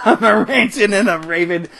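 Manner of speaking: hammy hillbilly lilt on "a ranting and a raving", laughing; folksy mock drawl. Vlogger mocks his own rant in a hammy folksy laughing voice. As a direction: ham up "I'm a ranting and a raving" with a chuckle. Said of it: "I am ranting and a raving".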